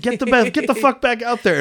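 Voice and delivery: high, loud urging shout; acted barked order. Retelling Russell ordering the fighter back, high mock shout.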